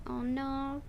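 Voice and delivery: ordinary talk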